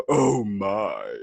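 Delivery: loud, drawn-out campy exclamation; gasp, then stretch it out with relish; Captain Sulu. Acted. Joking with buddies in a store, Sulu impression. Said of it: "I'll do, like, a Captain Sulu".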